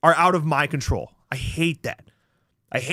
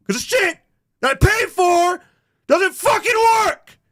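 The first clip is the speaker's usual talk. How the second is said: angry shout; 13 semitones higher, 5 dB louder than usual. Real, not acted. Angry rant about broken stream.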